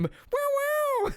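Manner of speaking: very high guitar squeal; imitated guitar riff. Game master repeats guitar sound as brothers leave, high squeal. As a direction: wail like an electric guitar. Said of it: "you hear that same".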